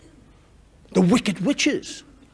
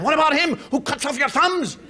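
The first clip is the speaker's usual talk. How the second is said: normal talking voice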